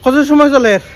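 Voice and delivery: panting after jumping rope; gasping between words; out of breath. Real, not acted. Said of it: "শ্বাস ফুলে গেছে".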